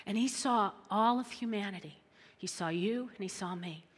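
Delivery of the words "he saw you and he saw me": ordinary delivery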